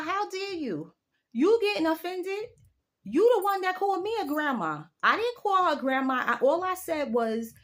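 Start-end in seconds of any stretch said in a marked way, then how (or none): 0.0-4.8 high, indignant comeback; indignant retort. Retold or imitated. Telling off the friend who called her grandma, indignant and high. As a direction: throw it back at her, offended.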